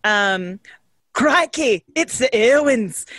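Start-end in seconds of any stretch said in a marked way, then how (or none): 1.1-3.1 put-on Australian accent. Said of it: "talking in an Australian accent".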